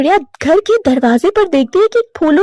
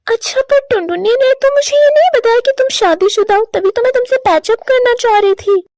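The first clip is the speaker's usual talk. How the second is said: higher, taken-aback explanation; surprised ex; 7 semitones higher than usual. Acted. Lado learning Kalu is married, higher surprised voice. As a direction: realise you were tricked.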